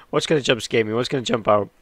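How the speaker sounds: loud nervous bracing; scared horror game streamer. Real, not acted. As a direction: brace loudly for a jump scare.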